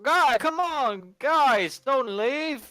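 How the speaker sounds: put-on drunken yelling. Drunk man begs departing friends to stay, pleading holler. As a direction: beg them loudly to stay. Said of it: "all this drunken yelling".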